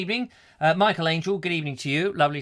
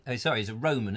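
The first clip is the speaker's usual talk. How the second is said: usual speaking voice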